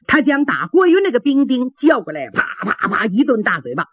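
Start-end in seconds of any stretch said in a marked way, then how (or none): none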